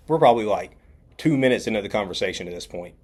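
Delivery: normal talking voice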